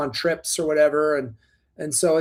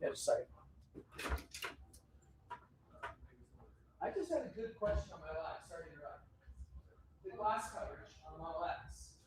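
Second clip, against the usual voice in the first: far from the mic; 4 semitones higher, 25 dB quieter than usual. Real, not acted. Walks off to ask colleagues.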